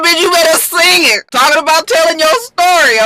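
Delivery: loud high hype; hyped cheer. Real, not acted. Cheering her song on.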